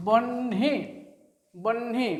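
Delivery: "বহ্নি" split in two, long n then hi; n before h. Shown as a demonstration. Teacher showing how it sounds, বহ্নি with n ahead of h. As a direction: say বহ্নি as "bonn-hi", n held. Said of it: "আগে হয়ের উচ্চারণটা আসছে না".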